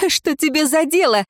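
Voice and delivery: высокий вызывающий возглас; exclaiming. A narrator's reading. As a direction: fling it at him, voice up high. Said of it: "воскликнула Ирейн".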